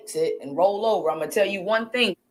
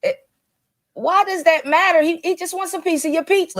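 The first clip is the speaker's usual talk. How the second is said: exasperated; 9 semitones higher than usual. Real, not acted. Exasperated reaction to the wife.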